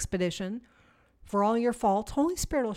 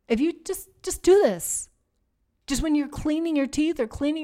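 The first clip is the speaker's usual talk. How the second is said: emphatic challenge; 5 semitones higher, 4 dB louder than usual. Real, not acted. Challenging the sisters.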